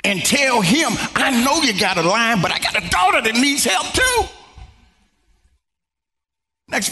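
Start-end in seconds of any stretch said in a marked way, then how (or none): 1.1-4.2 bold appeal climbing high at the end; acted mother standing her ground, pitch rising; gutsy plea. Mother interrupting Jesus for her daughter, gutsy and insistent. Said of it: "This woman had guts to come to Jesus".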